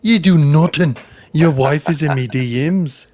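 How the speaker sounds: brash trash-talk in a hammed-up Irish accent; Conor McGregor impression. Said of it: "this is [name]'s Conor McGregor".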